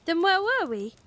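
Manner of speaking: high, bewildered question; puzzled, asking; Paul baffled, asking his sister, high and bewildered. Acted. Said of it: "Paul looked bewildered".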